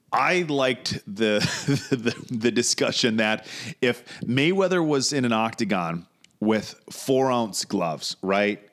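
ordinary delivery

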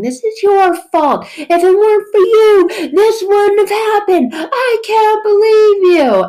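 high, loud acted tirade; hammed-up outraged finger-pointing; furious blaming. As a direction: shout each accusation, stretching the last. Acts out blaming someone to face.

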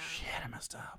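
unvoiced guilty whisper. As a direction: whisper the confession. Whispered aside after dig.

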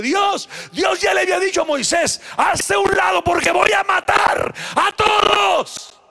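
loud, high preacher's shout; full-force preaching. Acted. Pastor retelling God's words to Moses, shouting. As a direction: thunder out God's warning.

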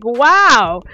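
retold amazement. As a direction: re-enact being amazed by the gift. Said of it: "I was just like".

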